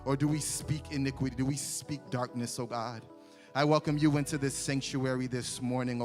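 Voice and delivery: ordinary delivery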